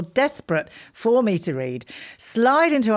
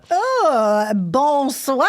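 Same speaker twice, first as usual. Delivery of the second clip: higher, louder French greeting; French accent; 6 semitones higher, 4 dB louder, much slower than usual. Pretend. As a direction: sing out bonsoir in French style.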